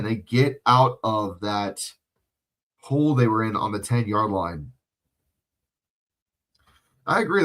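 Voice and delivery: normal talking voice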